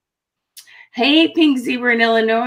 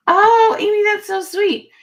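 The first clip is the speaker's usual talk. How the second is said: much higher, gushing; touched and delighted; 12 semitones higher, 3 dB louder than usual. Real, not acted. Amy said something sweet, high delighted. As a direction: gush over the kind comment. Thanks a viewer.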